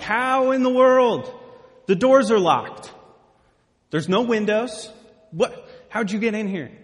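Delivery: loud, high startled outcry, stretching the first words; freaked-out disbelief. Acted. Disciples startled by a guy appearing in a locked room, freaking out. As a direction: act out frightened men blurting in alarm. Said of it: "And they're freaking out. They're scared".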